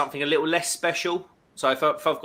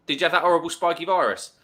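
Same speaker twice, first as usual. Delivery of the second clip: ordinary talk, nothing marked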